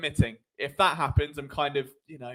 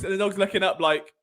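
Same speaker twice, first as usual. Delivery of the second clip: normal talking voice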